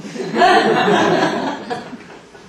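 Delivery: loud high laugh at own joke; big laugh. Acted. Producer laughing at his own quip, loud. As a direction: roar with laughter.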